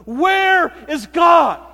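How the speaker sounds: very loud, high, slow shout; furious shout. Retold or imitated. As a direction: slam the question home after banging the fist. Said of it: "banged his fist".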